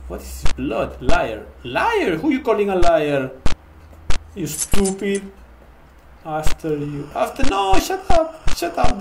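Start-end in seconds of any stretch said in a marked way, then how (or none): 1.6-3.3 loud, offended comeback; indignant retort. Real, not acted. Retorts to the game's accusation. 7.1-9.0 high, loud frantic protest; agitated high-pitched outburst; panicked yelling. Real, not acted. Pursued, tells game to shut up.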